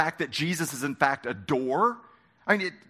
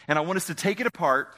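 ordinary delivery

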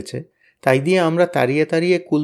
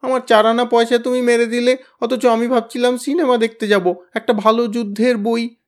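higher, louder tearful complaint; tearful whine; 9 semitones higher, 4 dB louder than usual. A narrator's reading. Kyabla whimpers to Tenida about his stolen money, tearfully. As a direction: whimper it out like a cheated boy. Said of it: "ক্যাবলা এবারে কেঁদে ফেলল".

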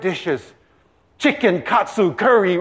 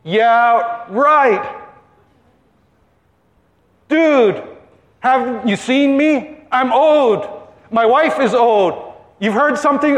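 higher-pitched, incredulous comic protest; disbelieving old man; 4 semitones higher than usual. Acted. Zechariah answers the angel, in comic disbelief. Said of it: "because of Zechariah's unbelief".

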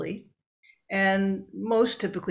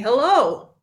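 a bright "Hello," higher and louder; a cheery greeting "Hello" pitched up; demo hello; 6 semitones higher, 9 dB louder than usual. Shown as a demonstration. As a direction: sing out "Hello" as an example. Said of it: "as I'm saying".

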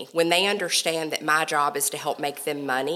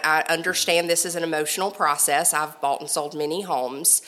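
ordinary talk

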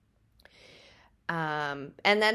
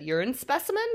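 higher, rising echo question; questioning surprise; 7 semitones higher, faster than usual. A narrator's reading. Patient echoes nurse's demand, mildly taken aback. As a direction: query it back in mild disbelief.

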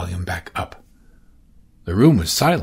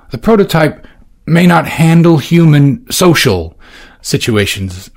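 higher, louder, earnest warning; Valentine's worried voice; 5 semitones higher, 7 dB louder than usual. Acted. Valentine earnestly warning Orsino about the prototype. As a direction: press the worry home. Valentine explains her fears.

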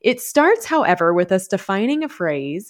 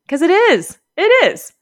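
much higher and louder, laughing insistence; emphatic outburst; 10 semitones higher, 7 dB louder than usual. Real, not acted. Host confirming to authors that ads are salesy, high loud emphatic outburst. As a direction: blurt it out, then hammer it again.

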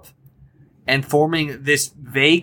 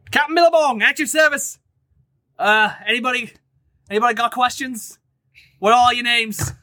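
boisterous captain; 8 semitones higher than usual. Acted. GM greeting the new crew as a brash high-voiced captain. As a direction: bellow a welcome as a brash captain.

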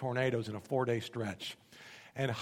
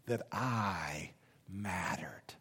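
very slow, low whisper; awed whisper; 8 semitones lower, 9 dB quieter, much slower than usual. Retold or imitated. Nurse realizing his worth today, whispered slowly as if near tears. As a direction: breathe it out word by word, choked. Retold climax of nurse's confession.

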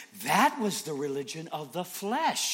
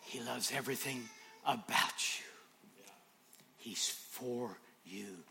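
whisper; 4 semitones lower, 9 dB quieter, slower than usual. Acted. Intimate sermon moment.

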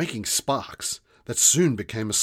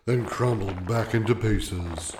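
gravelly voice; 6 dB louder than usual. A narrator's reading. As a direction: drag it out, bored rock. Warden continues.

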